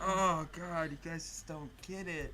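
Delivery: exasperated sigh. Retold or imitated. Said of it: "it's just like".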